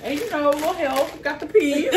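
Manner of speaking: laughing at the sister's habit. Real, not acted. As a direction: laugh it out, amused. Laughing over the bad peas.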